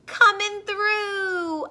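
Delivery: high, drawn-out call; yelled. A narrator's reading. Dump truck clearing the road, high stretched yell. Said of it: "yelled a dump truck".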